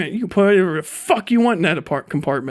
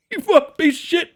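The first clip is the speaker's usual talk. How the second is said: pissed-off guy; 8 semitones higher than usual. Retold or imitated. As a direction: rant like an angry guy from the neighborhood. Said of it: "somebody's gonna be pissed".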